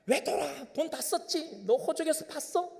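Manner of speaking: high, hammed-up scolding father; mock angry father. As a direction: play the furious dad throwing him out. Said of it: "아버지께서 뭐라고 했습니까?". (화난 아버지 흉내)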